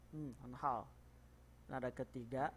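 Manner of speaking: ordinary talk, nothing marked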